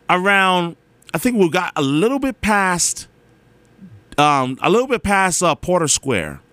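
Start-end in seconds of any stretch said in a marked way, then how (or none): none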